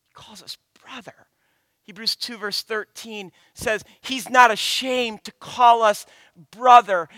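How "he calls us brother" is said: whispered, much softer. Real, not acted. Preacher to congregation, an intimate whisper. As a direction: breathe it out in a hush. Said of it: "preacher drops to a whisper".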